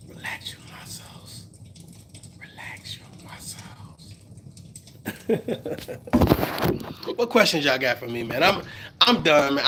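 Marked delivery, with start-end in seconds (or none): 0.1-4.1 mock soothing whisper, softer and slower; fading to a mock calming whisper. Shown as a demonstration. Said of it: "repeats the instruction, fading to a whisper". 5.1-7.1 a short, unvoiced laugh; breathy laugh. Real, not acted. Laughs at his own bit.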